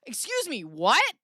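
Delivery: mock stunned what; mock outrage. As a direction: act stunned and offended. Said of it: "I'd be like".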